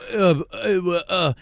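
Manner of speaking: high, loud mock sputter; flustered stammering. Caught prank caller fumbles for an answer, mock stammer. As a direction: imitate someone lost for words, in short bursts. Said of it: "And he's like".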